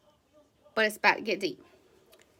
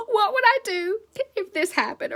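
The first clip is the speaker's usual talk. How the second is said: higher, imitating a tearful panic; mock sobbing worry; 8 semitones higher than usual. To her team, imitating herself crying over sad songs, mock tearful. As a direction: replay the worried thought, half-crying. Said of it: "And I'm just sobbing, like".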